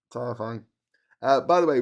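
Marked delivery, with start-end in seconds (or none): none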